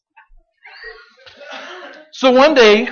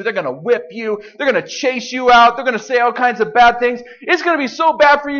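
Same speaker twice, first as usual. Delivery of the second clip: ordinary talk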